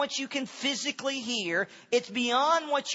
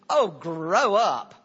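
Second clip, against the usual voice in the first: loud, low, drawn-out put-down; acted dismissive jab, slow and loud; mock scolding; 5 semitones lower, 6 dB louder, slower than usual. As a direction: scold them with heavy exasperation. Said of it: "don't think of that as a scolding".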